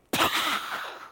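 breathy, voiceless burst noise; imitated explosion. Preacher mimics chaos breaking out in council, blown explosion noise. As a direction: puff out an explosion sound.

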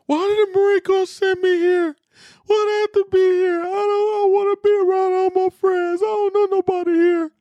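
very high, tearful whimpering complaint; imitating his younger self sobbing, high and whiny; mock bawling whine. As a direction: whine it like a homesick kid crying. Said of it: "and I bawled. Tears".

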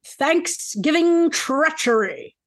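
slow, drawn-out theatrical title; dramatic title reading. Acted. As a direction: announce the title with grand, lingering drama. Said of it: "do it as dramatic as possible".

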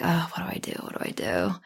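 hushed, anxious whisper to herself; retelling her inner panic in a whisper; panicked whisper. Retold or imitated. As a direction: whisper it like a frantic inner thought. Inner panic over choosing topic.

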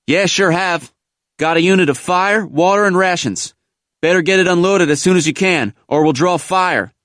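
high, urgent soldier calling out; brisk tractor driver. A narrator's reading. Driver to Marines about unloading, urgent hail. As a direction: call down orders over the engine.

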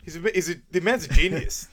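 hammed-up rave, climbing as it goes; mock gushing admiration. Host acts character praising the investigator, mock excited.

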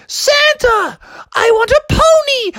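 very high excited shrieks; chasing kids. Acted. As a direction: squeal like a pack of children on the hunt. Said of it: "They're like".

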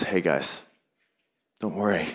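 tender reassurance from Jesus to his disciples; reassuring Jesus. Retold or imitated. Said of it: "Jesus speaks to them and says".

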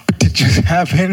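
put-on like a kid who expected a scolding; stunned disbelief. Child surprised at mom's reaction.

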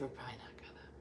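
hushed, apologetic whisper; whispered refusal. Retold or imitated. The landscaper whispering to Dina that the plants won't stay. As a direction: whisper the bad news gently.

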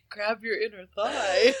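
low mock voice, breaking into laughs; Amy voice through laughter. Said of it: "I'm trying to do a voice and I'm laughing".

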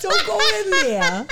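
put-on loud, high Boston accent shout. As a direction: yell it in a thick Boston accent. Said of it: "it is a Boston accent".

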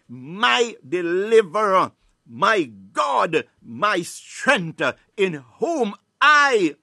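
slow, drawn-out booming declaration; loud, with conviction. Shown as a demonstration. Preacher leading listeners through the psalm, loud and convinced. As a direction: proclaim each phrase loudly so the enemy hears. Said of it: "say it with conviction and say it loud".

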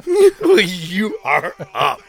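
slow words broken by laughter; laughing. Real, not acted. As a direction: drag it out slowly while laughing at the cue. Echoing cohost's cue, laughing.

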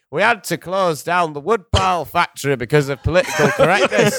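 Northern English man. Acted. Imagined town local bemoaning a closed factory, mock Northern English accent. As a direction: put on an exaggerated Northern English flat-cap accent. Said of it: "a man in a flat cap going".

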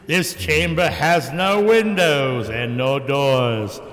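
slow, spooky tour narration; ride announcer voice. Pretend. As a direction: intone it like the ride's ghost host. Reciting Haunted Mansion ride intro.

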